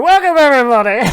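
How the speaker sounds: high, loud show-opener greeting; hammed-up welcome. Shown as a demonstration. Wanting to just open the show, hammed-up welcome. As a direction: belt out a welcome to the crowd. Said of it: "I really wanna just go".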